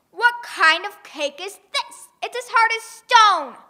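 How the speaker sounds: loud, slow, offended grumble; indignant giant. Acted. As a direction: sputter in outrage. Giant complains.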